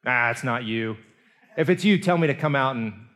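a bold mock challenge, called out loud; Peter calling out. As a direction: shout a dare out to him over the waves. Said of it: "Peter's like".

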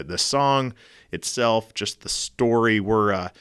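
usual speaking voice

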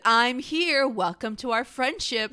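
hammed-up pushy friend at the door; mock loud announcement. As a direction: announce it like barging in. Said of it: "knocked down Yuki's door and said".

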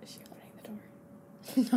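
hushed whisper; library whisper. Real, not acted. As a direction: whisper so nobody hears. In a library, girl waving.

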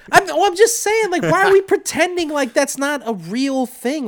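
worked-up, high and loud; excited rant. Real, not acted. Heated rant about the movie.

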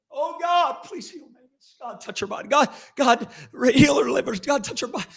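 high, loud, emotional pleading to God; pleading prayer. Retold or imitated. Preacher reenacting his own desperate prayer, high pleading. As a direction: beg God to heal her, pitch climbing. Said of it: "I was pleading with God".

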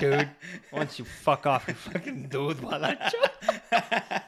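slow, laughing mock insult. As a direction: hurl it while cracking up.

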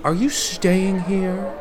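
put-on high, hesitant young man voice; timid stranger asking for help; nervous young man. Nick character talking to clerk.

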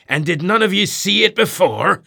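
high, loud demanding cry; cried out. A narrator's reading. Ahab hailing the perched men, crying loudly. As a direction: challenge the men above. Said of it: "cried Ahab".

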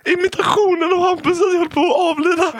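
helpless high-pitched laughing speech; talking while cracking up; laughing hard. Real, not acted. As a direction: squeal it out through uncontrollable laughter. Can barely talk for laughing.

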